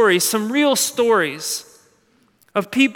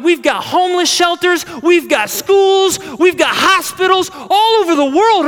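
rising higher and louder, list building to a peak; building excitement; 8 semitones higher, 5 dB louder than usual. Acted. Preacher voicing the church, building up excitement. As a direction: pile it on like a proud salesman.

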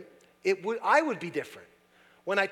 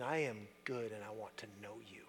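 tender; 7 semitones lower, 11 dB quieter than usual. Acted. God's tender words, retold.

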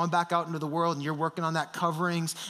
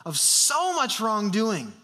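voice of shame; 6 semitones higher than usual. Acted. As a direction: sneer the accusations like a cruel prosecutor. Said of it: "Shame would say".

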